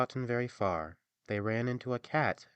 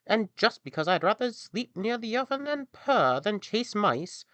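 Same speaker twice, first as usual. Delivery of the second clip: put-on much higher, louder whiny old cat; complaining cat; 12 semitones higher, 7 dB louder than usual. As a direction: whine it as the doomed cat. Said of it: "the cat replied".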